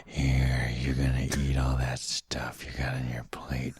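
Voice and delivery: low creepy whisper. Pretend. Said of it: "Isn't that creepy?".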